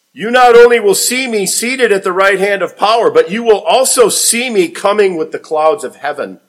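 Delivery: high, loud, soaring promise; climactic prophecy. Acted. Jesus warns the Sanhedrin of his return, loud and soaring. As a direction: build it to a triumphant peak.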